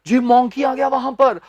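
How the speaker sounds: ordinary talk, nothing marked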